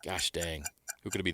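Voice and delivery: ordinary talk, nothing marked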